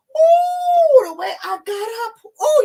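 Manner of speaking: high, loud whoop; exultant cry. Real, not acted. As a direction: crow it out proudly. Exultant retelling of standing up.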